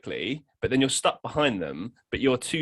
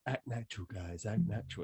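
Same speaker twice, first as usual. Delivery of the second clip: mock movie hush; 2 semitones lower, 12 dB quieter than usual. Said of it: "when you see in movies and they say".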